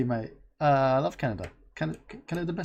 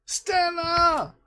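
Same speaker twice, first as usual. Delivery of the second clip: much higher, drawn-out winner's name; excited announcement. Real, not acted. Host reveals the winner to the stream, drawn-out excited. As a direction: call the name like a prize reveal.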